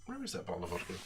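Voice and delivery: put-on drunk, mumbling. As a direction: slur it half to yourself. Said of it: "I can do a very good drunk person impression".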